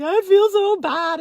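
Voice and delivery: high, loud, slow mock wail; mock distress. Messaging her improv friends, mock distressed wail. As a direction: whine it out like a dramatic apology.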